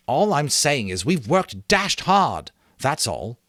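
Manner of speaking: slow, high defensive insistence; mild indignant boy. A narrator's reading. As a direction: stand your ground. Ralph defends himself.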